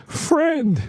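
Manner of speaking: one high, drawn word, falling away; choked-up movie quote. Retold or imitated. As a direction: imitate a man choking out his answer. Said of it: "like that".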